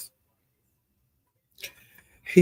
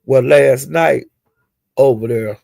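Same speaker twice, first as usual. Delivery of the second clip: ordinary talk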